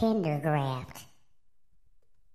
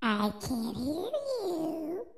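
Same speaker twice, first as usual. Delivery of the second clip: put-on high, slow call-out to kids; kids show host; 10 semitones higher, much slower than usual. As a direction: coax a shy crowd of kids. Host coaxing the audience.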